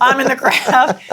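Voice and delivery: loud joking. Real, not acted.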